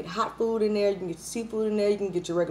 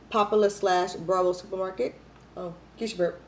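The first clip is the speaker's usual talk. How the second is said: ordinary talk, nothing marked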